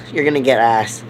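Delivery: low, growled taunt; deep put-on voice. Warning Bobby he'll get shot, low growl. Line repeated, second deep.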